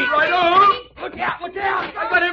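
high, loud warning cries; shouting in a struggle. Acted. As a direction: yell across the scuffle. Brawl, pulling man from car.

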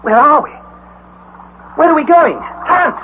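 high, loud panicked cries; frightened. Acted. Harry panicking in the shaft, calling to Hans, frightened and loud. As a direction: call out in rising panic. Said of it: "What is frightening you".

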